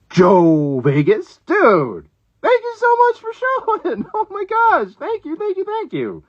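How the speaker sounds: very high, giddy gushing; real fangirl excitement, pitch shooting up; thrilled squeal. Real, not acted. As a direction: squeal your thanks at a surprise guest. Thrilled that a friend showed up.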